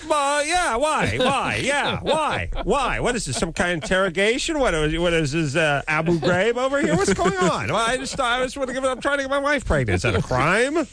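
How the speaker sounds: high-pitched indignant protest; offended squeaky self-defense; defensive protest. Retold or imitated. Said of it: "And I'm like".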